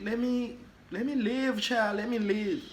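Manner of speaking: high-pitched pleading to be spared; exasperated plea. Acted. Teller imagining begging a mugger off, exasperated plea.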